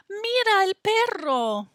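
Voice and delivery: put-on high bright child voice; Kate's voice. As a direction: voice Kate as an excited little girl. Kate voiced in picture book.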